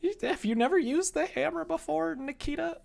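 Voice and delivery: very high, loud squeal; mock incredulous question. Streamer teasing Nikita about hammers in a high mock squeal.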